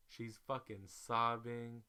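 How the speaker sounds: ordinary talk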